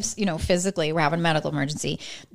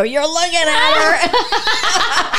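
slow, high, breaking into laughter; laughing through it; 9 semitones higher, 5 dB louder, much slower than usual. Retold or imitated. Telling her date she is the crazy one, slowly and laughing. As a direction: drawl it out while cracking up.

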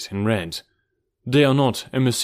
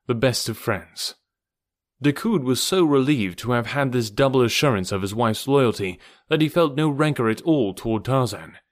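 ordinary delivery

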